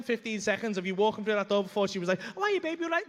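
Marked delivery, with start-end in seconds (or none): 2.2-3.1 high-pitched wifely greeting; suspicious wife. Retold or imitated. Wife greets husband at the door, high-pitched imitation.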